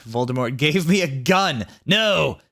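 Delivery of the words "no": sharp high no; refusal. Acted. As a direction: cut him off with a high no. Refusing Voldemort's order.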